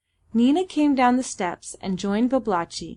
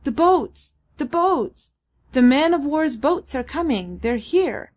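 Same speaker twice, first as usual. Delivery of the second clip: raised, higher-pitched cry of alert; shouting; 5 semitones higher, 4 dB louder than usual. A narrator's reading. Man in the foremost canoe alerting Sambir, shouting. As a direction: call out the news, moderately loud. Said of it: "stood up shouting".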